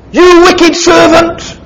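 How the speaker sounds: high, slow, damning condemnation; angry king. Acted. King condemning the wicked servant, slow high anger. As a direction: lean on each word like a verdict.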